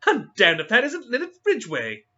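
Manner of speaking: high, loud excited exclamation; suddenly excited. Acted. Tim spotting a famous heiress, high excited cry. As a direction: burst out on spotting her. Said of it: "his voice suddenly excited".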